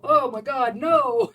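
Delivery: loud, laughing mock panic from the cats; mock horrified cats. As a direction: play the cats recoiling, laughing. Cats' mock horrified reply.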